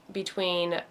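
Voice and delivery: ordinary delivery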